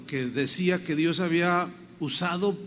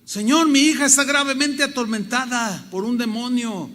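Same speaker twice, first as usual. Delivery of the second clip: loud, raised plea; clamor; 6 semitones higher, 7 dB louder than usual. Acted. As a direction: cry out to the Lord so all hear. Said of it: "Pero ella clamaba". (clamando a gran voz, suplicante)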